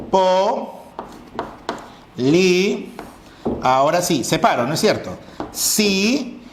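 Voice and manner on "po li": po, li, each syllable said slowly and separately; syllables split apart. Shown as a demonstration. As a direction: spell it out one syllable at a time. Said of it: "el silabeo quedaría". (separando sílabas po, li)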